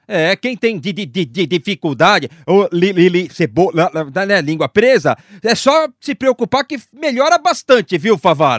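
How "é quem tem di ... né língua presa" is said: stammering on purpose; mock stutter. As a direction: repeat the first syllables. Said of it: "O que foi gago".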